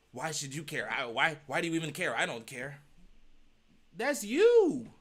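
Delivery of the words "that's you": high, loud accusation; pointed jab. Real, not acted. Telling the man it is him, high and loud.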